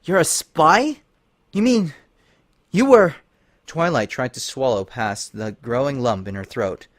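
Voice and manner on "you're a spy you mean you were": stunned disbelief. Acted. Twilight stunned.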